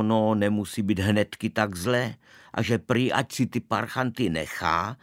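ordinary delivery

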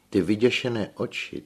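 ordinary delivery, nothing marked

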